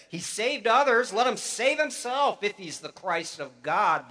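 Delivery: loud, high mocking jeer; a sneering ruler shouting scorn; sneering taunt. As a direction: throw the taunt up at him with contempt. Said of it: "the rulers even sneered at him".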